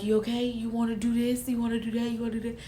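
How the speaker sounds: soft, hushed imitation of a doting partner; imitated fussing. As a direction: fuss quietly, one question after another. Retells Lucas fussing over her.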